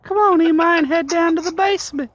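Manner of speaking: put-on high, creepy old man voice. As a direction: lure him in like a creep.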